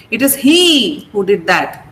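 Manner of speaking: HE lifted and lengthened, the rest falling away; stress on HE. Shown as a demonstration. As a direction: punch "he" to single out the person. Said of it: "draw the attention of the listener".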